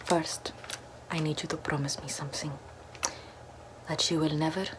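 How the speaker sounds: ordinary talk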